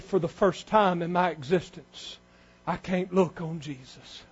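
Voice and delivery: very soft, low voice of God; hushed quote. Acted. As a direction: let it sink almost to nothing, low and grave. Voicing God's grief at Calvary.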